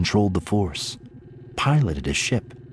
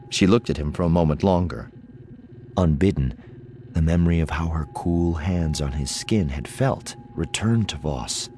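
ordinary delivery

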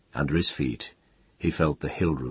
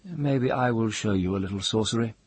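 lightly voiced taunt, pitched up; taunting rival; 7 semitones higher than usual. A narrator's reading. Taunting dialogue in a story.

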